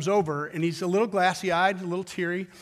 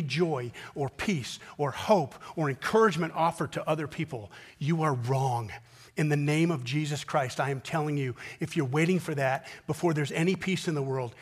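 preaching; 2 semitones lower than usual. Acted. Preacher exhorting the congregation, earnest sermon. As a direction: drive each promise home to the congregation.

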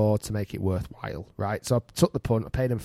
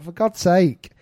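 higher and louder, fed up with endless retakes; exasperated; 7 semitones higher, 6 dB louder, slower than usual. Retold or imitated. Golfer fed up with the cameraman's retakes, exasperated outburst. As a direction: let the frustration burst out. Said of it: "I'm like".

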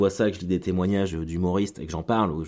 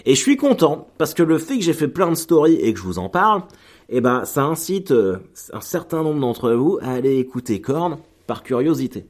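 excited; 6 semitones higher, 5 dB louder than usual. Real, not acted. Excited about [name].

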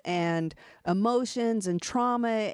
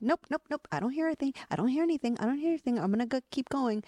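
dismissive denial; 6 semitones higher, much faster than usual. Retold or imitated. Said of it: "And we're like".

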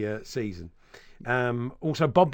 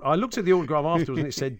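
normal talking voice

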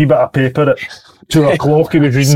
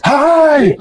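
mock calling out; 12 semitones higher than usual. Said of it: "shouting teammate voiced".

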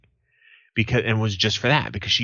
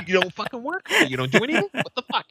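mock Boston tough-guy accent, much higher; Boston line; 13 semitones higher, faster than usual. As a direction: fire it off in a hammed-up Boston accent. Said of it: "It's such a Boston line".

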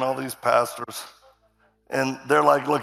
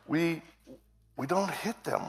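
soft, careful mock-patient correction; real calm; 3 semitones higher, 8 dB quieter than usual. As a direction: imitate someone explaining very calmly, with pauses. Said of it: "he's talking real calm".

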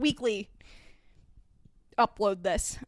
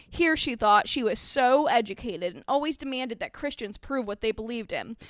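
usual speaking voice

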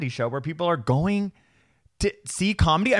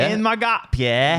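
higher, slow Australian attempt; attempted Australian accent; 4 semitones higher, 4 dB louder, slower than usual. Co-host retrying after being called British, attempted Australian accent. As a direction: stretch the vowels into a rough Australian accent. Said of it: "do an Australian accent".

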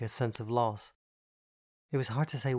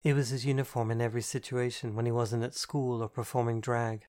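ordinary delivery, nothing marked

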